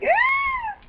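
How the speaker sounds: very high, thin squeal of excitement; uncontained excited squeal. Real, not acted. Vlogger overcome by news of her friend, excited squeal. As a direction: let a tiny high squeal escape. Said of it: "I couldn't contain it".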